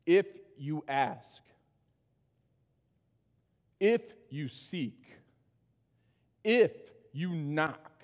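slow, each "if" pressed and held before the verb; stress on if. Shown as a demonstration. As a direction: lean hard on "if" every time, slowly. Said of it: "let me put some emphasis on this".

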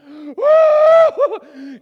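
high, very loud mock panic cry; a sudden startled yelp; panicked cry. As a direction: blurt it out as a shriek.

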